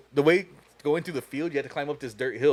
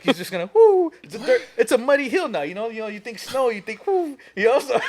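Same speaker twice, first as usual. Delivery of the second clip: high, louder, laughing excitedly; laughing as he recalls his dumb plan; laughing while explaining; 8 semitones higher, 6 dB louder than usual. Real, not acted. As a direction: giggle through the explanation.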